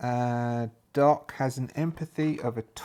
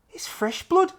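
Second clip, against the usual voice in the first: much higher, eager put-on voice; high pleading Jack; 4 dB louder than usual. Jack begs Staff to let him come, in a high eager voice. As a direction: beg to come along, pitched up and eager.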